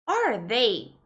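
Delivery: clear, careful textbook questions; model question reading. Shown as a demonstration. Teacher reads example questions to students, carefully. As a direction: read each one out as a model.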